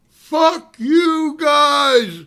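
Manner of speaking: long-drawn high, loud taunting holler; yell at full lungs. Retold or imitated. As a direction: holler it across a field, stretching the words. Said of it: "Dave yells at the top of his lungs".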